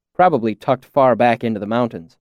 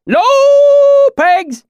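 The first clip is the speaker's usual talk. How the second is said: high, loud drawn-out pig call; distant herding call; 8 dB louder than usual. A narrator's reading. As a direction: call the pigs in through the trees. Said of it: "A voice came through the trees".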